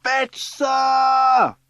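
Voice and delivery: high, loud, drawn-out cry for help; yelling. A narrator's reading. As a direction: yell across the playground to send for help. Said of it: "yells Strawberry".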